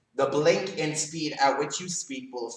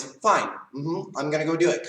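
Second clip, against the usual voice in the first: willing agreement; 3 semitones higher than usual. Shown as a demonstration. Child agreeing to clean the room for parents, willing. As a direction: agree as if happy to do it. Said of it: "The first one is".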